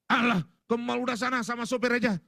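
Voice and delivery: high, dismissive husband retort; irritated go-away tone; curt brush-off. Acted. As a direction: wave off the request impatiently. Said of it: "Jangan bentak, jangan kasar".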